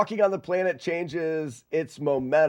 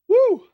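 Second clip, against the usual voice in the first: high woo cheer; 9 dB louder than usual. Real, not acted. Excited cheer.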